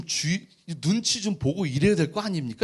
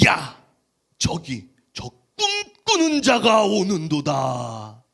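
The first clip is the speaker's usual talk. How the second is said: slow, drawn-out theatrical proclamation; scornful brothers; 4 dB louder, much slower than usual. Acted. Brothers spotting the dreamer in the distance, slow theatrical scorn. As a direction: stretch it out with mock grandeur.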